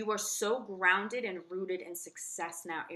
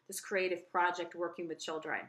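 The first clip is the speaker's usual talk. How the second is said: ordinary talk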